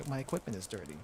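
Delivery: mocking Narhan. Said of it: "all Narhan has to say is".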